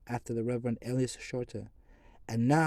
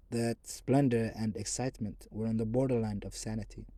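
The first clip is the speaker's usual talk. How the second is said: ordinary delivery, nothing marked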